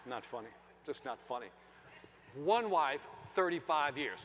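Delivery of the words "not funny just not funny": low, soft deadpan disapproval; judging disapproval. Retold or imitated. Comic voicing the disapproving side of the room, quiet judgment. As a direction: mutter it like a judgmental listener. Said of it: "I felt the judgment".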